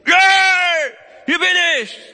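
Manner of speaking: loud shouted call, high and bright; mock shout. Preacher mocking a God who shouts for attention. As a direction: shout it out like God announcing himself. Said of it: "Warum schreit er nicht?".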